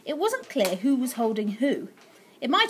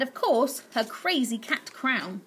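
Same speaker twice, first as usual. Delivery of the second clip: ordinary delivery, nothing marked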